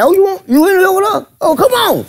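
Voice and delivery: high sassy mock woman voice; sassy Dirty. Dirty South sassing Teddy Carpenter, in a high sassy mock voice. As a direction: toss it off with high sass.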